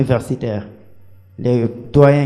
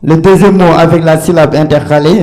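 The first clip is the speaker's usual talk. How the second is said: eager answer; 5 semitones higher, 9 dB louder than usual. Real, not acted. Candidate announces answers to jury.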